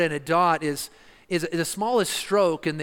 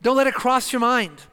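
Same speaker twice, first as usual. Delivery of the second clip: louder, higher insistent preaching; emphatic preaching; 6 semitones higher, 4 dB louder than usual. Acted. Preacher warning the congregation not to think it, forceful. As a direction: drive the command home to the listeners. Preacher hammers home a command.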